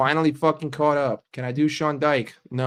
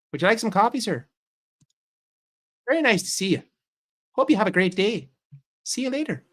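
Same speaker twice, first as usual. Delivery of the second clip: put-on higher, sing-song Minnesota accent; 7 semitones higher, faster than usual. A Minnesota server greeting a customer, bright Minnesota accent. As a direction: play the friendly Minnesota waitress in accent. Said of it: "and you talk like this".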